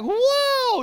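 high, breathless whoa of wonder; amazed whoa. Retold or imitated. Pastor recalling first falling for his girlfriend, high amazed whoa. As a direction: gush at how amazing she is. Smitten by the beautiful Swede.

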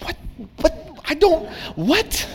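high, dumbfounded stammer; can't make sense of her answer; baffled. Retold or imitated. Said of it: "I'm like".

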